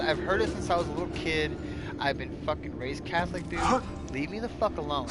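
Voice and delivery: usual speaking voice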